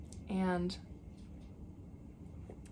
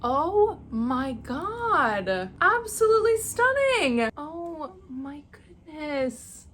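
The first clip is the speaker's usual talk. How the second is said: much higher and louder rising gasp of praise; overwhelmed delight; 6 semitones higher, 7 dB louder, slower than usual. Real, not acted. Opening a gift from her actress, overwhelmed delight. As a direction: let the awe burst out.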